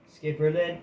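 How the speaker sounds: plain reading voice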